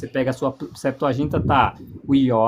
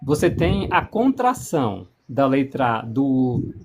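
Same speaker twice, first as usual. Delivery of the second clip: ordinary talk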